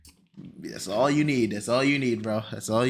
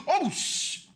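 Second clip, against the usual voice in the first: panicked; 12 semitones higher than usual. Real, not acted. Something goes wrong on stream.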